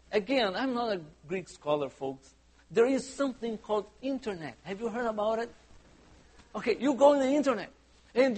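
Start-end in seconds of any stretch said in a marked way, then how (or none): none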